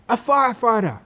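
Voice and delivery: put-on high, eager shout of a kid. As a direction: call out the answer keenly. Kids shouting answers.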